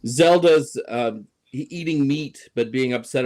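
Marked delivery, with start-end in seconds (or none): none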